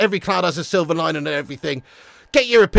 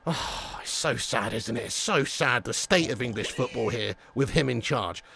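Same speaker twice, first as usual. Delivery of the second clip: mock sad lament; 9 semitones lower, 11 dB quieter than usual. Real, not acted. Lament about English football.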